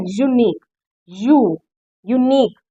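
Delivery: यूनिक, then यू alone, then यूनिक whole; sounded-out initial Y. Shown as a demonstration. Teacher isolating the first sound for students, यूनिक opened on "yoo". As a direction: break off the opening "yoo" of यूनिक. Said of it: "तो य sound आ रही है".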